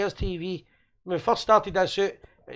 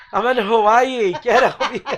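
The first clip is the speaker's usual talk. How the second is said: louder, drawn-out mock phone call; imagined phone boast; 2 semitones higher, 6 dB louder than usual. Co-host imagined phoning from Hawaii, gleeful mock boast. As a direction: playfully stretch it out loud, laughing.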